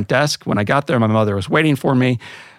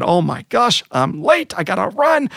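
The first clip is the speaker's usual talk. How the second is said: higher, a mild mock rush; lightly voiced hurried doctor; 8 semitones higher than usual. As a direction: imitate the doctor noticing he's late.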